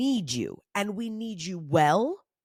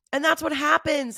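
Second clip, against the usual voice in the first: ordinary talk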